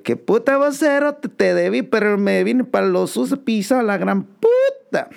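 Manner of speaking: very high, hammed-up slangy voice; cartoonish high Guatemalan voice; exaggerated Guatemalan voice. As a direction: ham it up as a loud Guatemalan guy. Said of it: "Y yo le digo".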